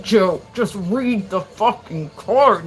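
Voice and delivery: slow, drawn-out fed-up command; exasperated order. Retold or imitated. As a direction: spell it out like talking to a slow child. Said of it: "and they're like".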